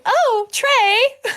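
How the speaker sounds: high, loud mocking call of the name; mocking sing-song. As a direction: tease the name like a kid across the yard. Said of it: "she runs across my yard just going".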